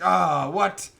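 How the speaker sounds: loud, astonished mock captain; hammed-up loud surprise; Vince Vaughn. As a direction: play an officer eating his words, loudly. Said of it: "he comes over to Vince Vaughn".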